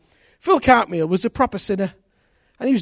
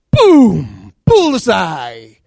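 boom bullseye; 8 dB louder, much slower than usual. Acted. God's dart hits home.

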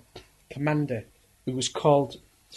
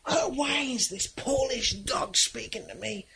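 high, slow scornful scream; screaming contempt; 9 semitones higher than usual. Retold or imitated. Nazi commander screaming at the prisoner, high scornful shriek. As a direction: shriek it in outrage. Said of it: "Fritz screamed and said".

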